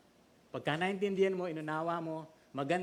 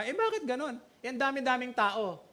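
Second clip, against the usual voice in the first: higher, puzzled listener voice; light imitation of a doubter, pitched up; mock doubting question; 7 semitones higher than usual. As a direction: ask it like a bewildered churchgoer.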